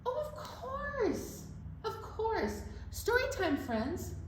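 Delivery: high, slow cartoon elephant; elephant puppet voice. Teacher voicing the elephant puppet greeting kids, high cartoon voice. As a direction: squeak it out as a cute puppet.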